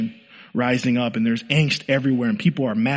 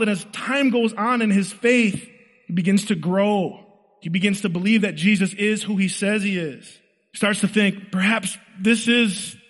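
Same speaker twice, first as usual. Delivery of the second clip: sustained higher-pitched sermon delivery; preacher carried along by the story, voice held high; impassioned preaching; 7 semitones higher than usual. Real, not acted. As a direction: build Peter's story with fervent, driving emphasis. Preaches Peter's story with rising intensity.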